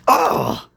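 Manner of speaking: whispered high gasp of shock; breathy startled oh; wheezed. A narrator's reading. James bumped from behind, wheezing. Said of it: "wheezed James".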